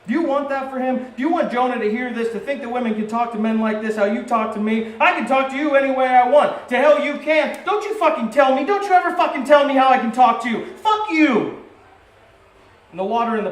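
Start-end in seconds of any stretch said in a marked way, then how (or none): 0.1-4.9 higher, louder accusing questions; mother confronting father in front of Jonah, heated; heated argument. Retold or imitated. Parents quarrel acted out. 5.0-11.6 much higher and louder, yelling curses; furious shouting. Retold or imitated. Parents quarrel escalates.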